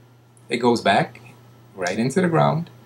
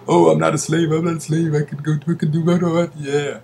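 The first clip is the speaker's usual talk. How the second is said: high, loud, rushed mock objection; mock protest; 7 semitones higher, 6 dB louder, faster than usual. Mimicking someone denying they are enslaved to capital, mock protest. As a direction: parrot a naive objector in a raised, insistent voice. Acted objection from a deluded person.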